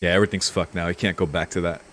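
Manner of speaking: ordinary delivery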